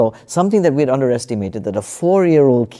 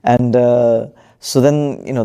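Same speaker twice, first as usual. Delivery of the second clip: ordinary talk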